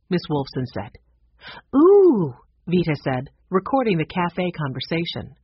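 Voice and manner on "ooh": high, loud thrilled ooh; excited ooh. A narrator's reading. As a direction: gasp it out with delight. Excited reaction to ghost story.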